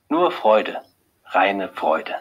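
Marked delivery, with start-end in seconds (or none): none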